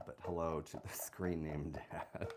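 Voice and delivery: giggling whisper; whispered laugh. Real, not acted. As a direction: laugh it under your breath. Laughing whisper at screen name.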